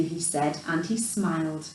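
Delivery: usual speaking voice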